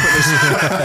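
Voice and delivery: usual speaking voice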